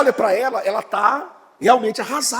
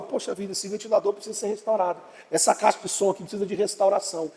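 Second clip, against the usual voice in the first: quick, much softer aside; muttering to himself; 7 dB quieter, faster than usual. Retold or imitated. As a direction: mumble it under the breath, fast. Muttering to himself about fan.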